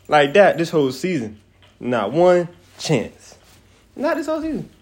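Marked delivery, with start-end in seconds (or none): 4.0-4.7 incredulous squeal. Real, not acted. Incredulous rant about Commanders.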